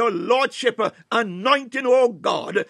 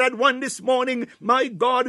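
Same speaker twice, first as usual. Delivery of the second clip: normal talking voice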